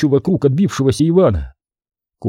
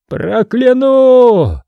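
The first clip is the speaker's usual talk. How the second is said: long-drawn, high cry of damnation; straining yell; 11 semitones higher, 5 dB louder, much slower than usual. A narrator's reading. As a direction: strain to out-shout everyone with a threat. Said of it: "надрывался Иван".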